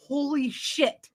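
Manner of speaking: imitating the detective striking gold; awed holy shit. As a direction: let it sink in, slowly. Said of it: "And he's like".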